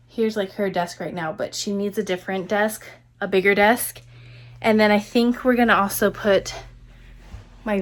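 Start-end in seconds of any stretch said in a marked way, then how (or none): none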